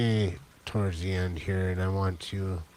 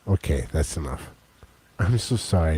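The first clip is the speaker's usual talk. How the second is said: usual speaking voice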